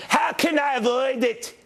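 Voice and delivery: high laughing Scottish accent put on; mock Scottish accent. Host teasing himself after Scotland clip, mock Scottish accent. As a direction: slip into a jokey Scottish accent while laughing. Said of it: "don't do your Scottish accent".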